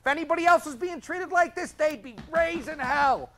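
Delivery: ordinary delivery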